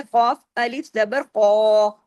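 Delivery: slow spelling-out ending on a heavy, long "कौ"; "कौ" thick and rounded, held long; heavy full-mouthed vowel. Shown as a demonstration. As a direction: fill the mouth on "कौ". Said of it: "मोटे हर्फ इसके साथ आ गया".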